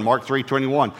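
usual speaking voice

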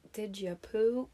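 slow, lightly voiced mom asking; a gentle hint of a matter-of-fact mom; imitating her mom. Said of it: "your precious mother ... being like".

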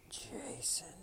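high, hushed whisper of the name; eerie whisper. A narrator's reading. Mysterious voice calling to Jason, eerie whisper. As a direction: whisper it like a ghostly summons. Mysterious voice calls in frozen room.

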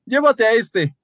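high hasty voice; Paolo, pushing friend forward. A narrator's reading. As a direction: shove the blame off quickly. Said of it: "dijo Paolo".

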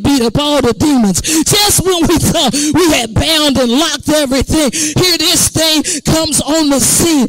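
loud, rising deliverance sermon; fiery preaching. Acted. Preacher binding spirits before the congregation, full force. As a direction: preach it, building to a roar.

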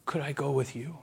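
soft, hushed begging, almost a whisper; a frightened man whispering a plea; whispered pleading. Acted. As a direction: plead under your breath, afraid to be refused. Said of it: "I imagine that moment".

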